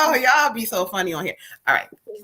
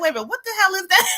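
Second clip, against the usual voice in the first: high, fast, half laughing, half shouting; shocked laughing yelp; 8 semitones higher, 3 dB quieter, much faster than usual. Retold or imitated. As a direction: blurt it out in startled laughter. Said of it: "I was like".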